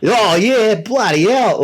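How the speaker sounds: broad Australian cadence, pitched high; Jason Ellis. Retold or imitated. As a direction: imitate a broad Aussie's swooping cadence. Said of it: "still has the cadence of an Australian".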